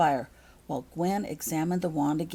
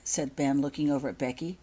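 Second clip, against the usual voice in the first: plain reading voice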